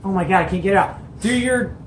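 ordinary delivery

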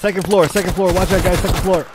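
high loud urgent warning shout; combat callout. Real, not acted. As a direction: yell the enemy position. Warning squad of enemy position.

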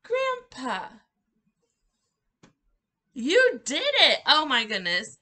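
high, loud joyful cheer; excited praise. Shown as a demonstration. Teacher cheering toddlers after grandpa, excited and loud. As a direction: cheer like they just won. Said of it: "We go, grandpa".

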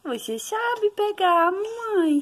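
"Você sabe pegar" up high, slow and sing-song. Real, not acted. Mother coaxing baby to grab toy.

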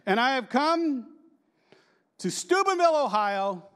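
high, slow and loud, soaring on Steubenville; grand God voice. Pretend. Preacher voicing God promising to come to Steubenville, grandly. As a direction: proclaim it like God on a stage.

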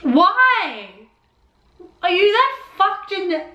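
high, horrified protest; appalled outburst. Real, not acted. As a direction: cry out in appalled disbelief. Revolted outburst at the show.

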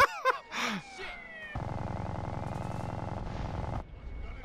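high, wheezy trailing laugh; laughing fit. Real, not acted. Player laughing after own scream, high laughing fit. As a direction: laugh helplessly until it fades.

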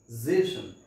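जेशन with a voiced z; ending जेशन said as zay-shun; zation ending. Shown as a demonstration. Teacher modelling the -zation ending, voiced z. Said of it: "इसे हम पढ़ेंगे जेशन".

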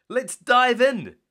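high, loud, slow cheer. Acted. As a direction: cheer the team on with big energy. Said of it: "Zuma cheered".

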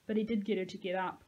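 usual speaking voice

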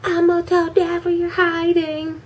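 high mocking tattletale voice; mean brother. Evil brother threatening to tell dad, mocking. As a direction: taunt like a bratty brother. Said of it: "He was so mean to her".

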